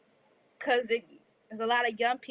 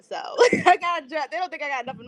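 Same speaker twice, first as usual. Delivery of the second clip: laughing; 3 semitones higher, 6 dB louder, faster than usual. Real, not acted. Laughing about the dress.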